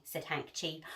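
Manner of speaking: ordinary delivery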